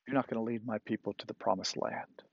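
soft, quick, understated pronouncement; quiet verdict. Acted. God telling Moses he won't lead the people, quietly. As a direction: deliver the sentence quietly.

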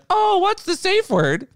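high, drawn-out mock question; confused commenter. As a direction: play a puzzled chatter, slow and high. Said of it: "the person will be like".

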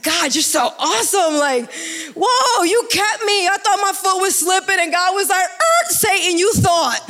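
high-pitched, exultant amazement at God; excited. Real, not acted. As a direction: burst into high praise, ending in a laugh. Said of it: "that gets me excited".

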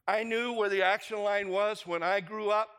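usual speaking voice

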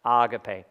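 Agape as AH-gah-pay, first syllable stressed; Agape in the Greek way; correct pronunciation. Shown as a demonstration. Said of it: "That's how you say it".

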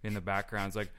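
normal talking voice

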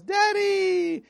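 very high, drawn-out mock child's squeal; child calling out. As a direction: call out like an excited little kid. Imitates child greeting her father.

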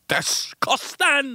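high, drawn-out mock scream; playful high-pitched yell. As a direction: scream it out high, stretching the word. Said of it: "I'd just scream".

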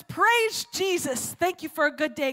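high, long-held call of praise; yelled praise. Retold or imitated. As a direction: holler it out to start the morning prayer. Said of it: "her yelling on her front step".

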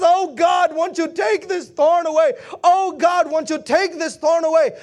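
loud, high imitation of desperate begging; hammed-up tearful prayer; mock crying plea. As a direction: wail a plea in preacher style. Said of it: "Here Paul starts crying and he says".

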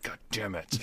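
muttered god damn it. Retold or imitated. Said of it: "I get fucking mad".

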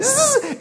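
short, very high drill buzz; quick burst of imitated drill; dental drill whine. Shown as a demonstration. Pastor imitating the dentist's machine, short high whine. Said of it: "it sounds like this".

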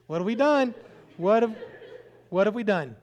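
high, loud comic dismay; mock alarm. Husband and wife eyeing their odd house guest, mock alarm. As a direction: play up the panicked regret for laughs. Couple's alarmed look, voiced for laughs.

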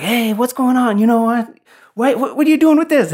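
high-pitched, fast-firing mock interrogation; excitable, high impression of someone demanding answers; suspicious intruder. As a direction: imitate a guy barging in with questions, voice jumping up high. Acting out suspicious guy walking in.

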